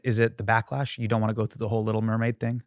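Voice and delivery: ordinary talk, nothing marked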